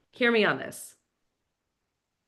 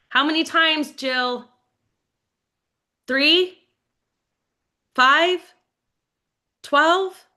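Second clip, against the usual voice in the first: rising incredulity; 6 semitones higher, 5 dB louder, much slower than usual. Real, not acted. Counting rising follow-ups, emphatic.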